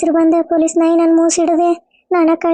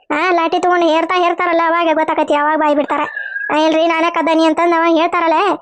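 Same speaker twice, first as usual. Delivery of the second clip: usual speaking voice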